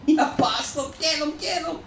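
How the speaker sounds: high, frantic shouts; wild high-pitched cheering on; excited yelling. Real, not acted. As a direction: urge the chase at full pitch. Frantic shouting during play.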